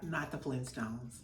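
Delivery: low, quiet, slow dig; hushed low shade. Retold or imitated. Mary smiling as she shades Meredith's bangs, low and quiet. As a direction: drop it low like a sly dig. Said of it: "she was like".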